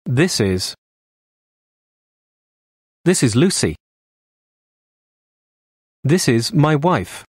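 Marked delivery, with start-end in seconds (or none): none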